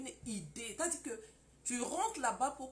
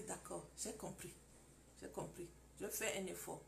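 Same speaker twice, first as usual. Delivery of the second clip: much softer, giving in; meek wife; 4 semitones lower, 10 dB quieter than usual. Acted. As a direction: quietly give in to him. Wife yields to shouting husband. (épouse soumise, voix très douce)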